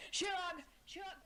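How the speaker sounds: high shouted name, fading on the second; calling out. Acted. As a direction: cry after him as he walks away. Calling after someone leaving.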